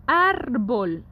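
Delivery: "Árbol" with a strong, drawn-out ÁR, falling on BOL; stress on ÁR. Shown as a demonstration. Teacher lets students hear the written accent, stressing ÁR in "Árbol". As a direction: hit the first syllable of "Árbol" hard. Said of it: "se pronuncia con mayor fuerza".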